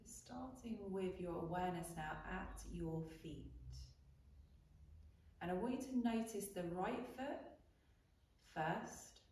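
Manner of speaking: soft, unhurried meditation voice with long pauses; guided meditation. Real, not acted. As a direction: guide them quietly into breath and body awareness. Leads a breathing body scan.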